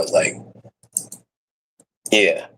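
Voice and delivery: ordinary talk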